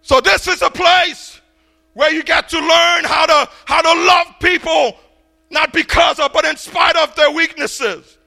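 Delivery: loud, full-throated pulpit preaching. Acted. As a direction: drive each point home to the pews. Preaching on Matthew eight.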